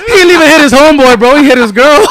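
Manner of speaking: cracking up at his own story; laughing while telling. Real, not acted. Retelling the punchline to friends through loud laughter. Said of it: "Why is that funny?".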